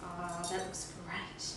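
ordinary talk

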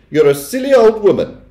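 high, loud insult; affronted, high-pitched scorn; contemptuous Missus Jenkins. A narrator's reading. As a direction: throw the insult in a high, haughty voice. Wife insulting grandmother.

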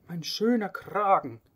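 high, loud wail over his collar; wailing lament. Acted. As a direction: cry out in despair for the collar.

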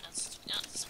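whispering. Real, not acted. Aside under breath.

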